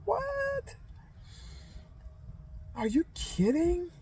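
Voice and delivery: very high, soft incredulous squeak; squealed disbelief. Retold or imitated. Mother learning the phone didn't ring in the car, high incredulous squeak. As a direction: rise into shocked disbelief.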